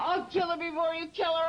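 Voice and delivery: fast furious threat; angry threat. Real, not acted. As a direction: snarl it fast and hard. Violent alter Enigma threatens.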